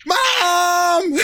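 high shouted cry for help; imitating father calling mom. Dad calling for mom during the prank, shouted cry. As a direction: scream for mom in alarm. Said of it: "he called for my mom".

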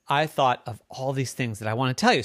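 ordinary delivery, nothing marked

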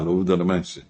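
ordinary talk, nothing marked

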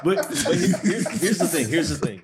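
words breaking into laughter; laughing. Real, not acted. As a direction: laugh while trying to speak. Tries to talk through laughter.

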